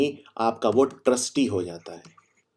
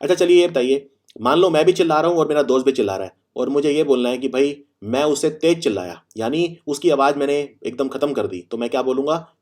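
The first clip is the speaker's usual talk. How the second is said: ordinary talk, nothing marked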